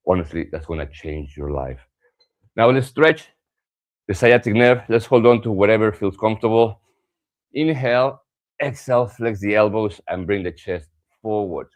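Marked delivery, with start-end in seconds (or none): 2.6-11.7 louder, higher instructor cues; class cue voice. Acted. Yoga teacher calls out cues to the class, louder and higher. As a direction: call out the stretch to the class.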